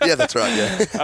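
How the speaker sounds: high giggly agreement; laughing. Real, not acted. Laughs at kangaroo joke.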